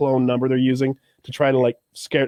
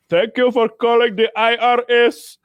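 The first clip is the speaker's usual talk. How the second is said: higher pitched mock Indian call-centre accent; 7 semitones higher, 3 dB louder than usual. Scam caller greeting the mark in a mock Indian accent. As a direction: play the scam caller answering the phone. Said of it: "this guy goes".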